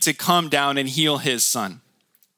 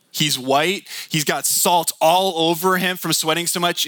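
ordinary delivery

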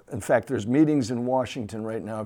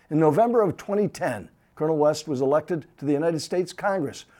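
louder, formal broadcast introduction; TV host intro; 3 semitones higher, 3 dB louder than usual. Acted. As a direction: introduce the war hero with ceremony. Host reads formal guest intro.

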